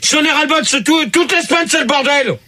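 high-pitched, exasperated complaint; fed-up anger. Real, not acted. Host complaining about weekly chaos to colleague, fed-up anger. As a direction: vent at the end of your patience. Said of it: "c'est toi qui es énervé".

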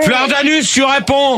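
high-pitched, exasperated prompt; impatient, pitched well up; impatient calling. Real, not acted. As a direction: demand an answer from a slow caller. Host impatiently calls on caller.

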